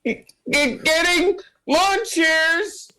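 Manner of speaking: very high, stretched-out squeal; eager high-pitched squeak, dragging the words; Smorty's voice. Acted. Smorty answering Pop Pop's request for lawn chairs, high squealing voice.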